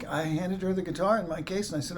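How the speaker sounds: ordinary talk, nothing marked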